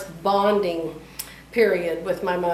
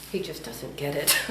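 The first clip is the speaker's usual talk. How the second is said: usual speaking voice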